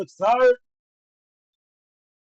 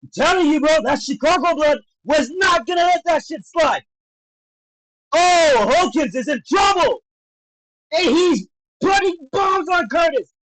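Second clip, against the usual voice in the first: raised, higher fight-call voice; excited shouting; 6 semitones higher than usual. Real, not acted. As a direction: shout the comeback as it unfolds. Calling a comeback live.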